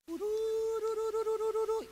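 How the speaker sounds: high, slow trilled call to the sheep; trilled "tururu" call; shepherd's call. Retold or imitated. Shepherd calling his sheep.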